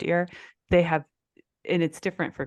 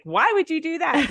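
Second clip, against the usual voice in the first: incredulous; 12 semitones higher than usual. Retold or imitated. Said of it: "I was like".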